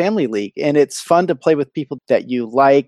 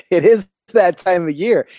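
laughing; 4 semitones higher, 4 dB louder than usual. Real, not acted. Laughing at the proclamation.

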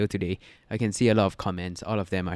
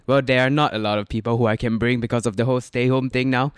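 usual speaking voice

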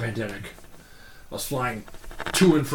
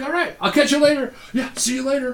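lightly pitched-up friendly farewell, much higher; casual goodbye; 11 semitones higher than usual. Retold or imitated. Parting from the actor in the airport, breezy high goodbye. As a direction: toss it off like leaving an old friend, pitched up. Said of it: "chit chat you would have with a friend".

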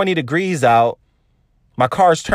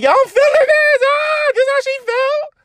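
falsetto swoon. Retold or imitated. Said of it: "I'm like".

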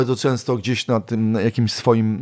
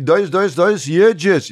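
cheering judge; 7 semitones higher, 5 dB louder than usual. Retold or imitated. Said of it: "ona na przykład tak mówi".

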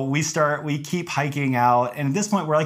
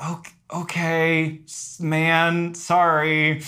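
higher, slow, hesitant placating; uneasy apology; 5 semitones higher, much slower than usual. Retold or imitated. Hikers answering an armed landowner, cautiously apologetic. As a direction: imitate backing off carefully from a threat. Retelling reply to landowner's threat.

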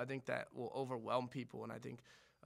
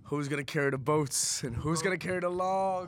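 Goggins impression; 3 semitones higher, 8 dB louder than usual. Closing the podcast with a loud Goggins-style challenge. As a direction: shout it like a drill instructor. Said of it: "something about David Goggins".

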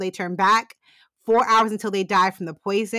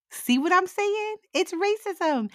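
high indignant; 9 semitones higher than usual. Real, not acted. Repeats point in outrage.

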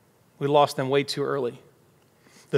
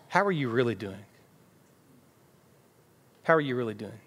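lower, gently falling question; non-judgmental, sincere; 3 semitones lower, 4 dB quieter than usual. Acted. Believer checks on someone who seems off, gently sincere. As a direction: ask softly, inviting them to open up. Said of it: "not in a judgmental way, but just".

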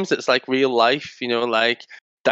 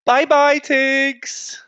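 sing-song goodbye; 12 semitones higher, much slower than usual. Pretend. Saying goodbye to Tiggs on cue, high sing-song. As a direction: pipe it high and draw out the name. Sign-off on request, put-on voice.

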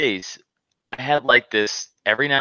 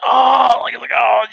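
pained oh; 11 semitones higher, 5 dB louder, much slower than usual. Retold or imitated. Retelling a sudden pain.

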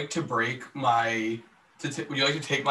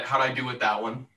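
normal talking voice

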